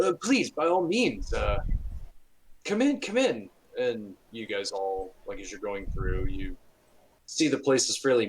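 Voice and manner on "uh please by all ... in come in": fussing host waving them inside; eager welcome. Acted. Priest urging the party inside, high and eager.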